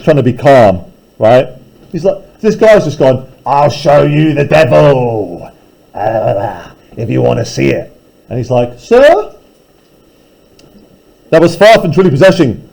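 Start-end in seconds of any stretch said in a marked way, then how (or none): 3.4-7.9 menacing Hyde. Acted. Hyde's menacing threat voiced. 8.8-9.3 startled sir. Acted. Lanyon taken aback.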